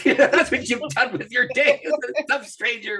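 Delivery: amused self-teasing through laughter; laughing at himself. Retold or imitated. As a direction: chuckle as you scold yourself.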